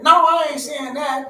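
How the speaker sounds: high, drawn-out objection; acted protest. Student objecting to being misunderstood, acted protest. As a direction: push back loudly, stretching it out.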